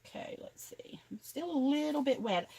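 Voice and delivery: hushed whisper to herself while checking; whispered note to self, no voice. Real, not acted. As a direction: breathe it out as a private aside. Checking drying transfers, whispering aside.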